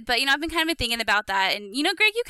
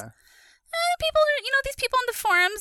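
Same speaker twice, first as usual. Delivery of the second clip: much higher, indignant; high defensive whine. Real, not acted. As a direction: squeak it defensively. Defensive squeak about forums.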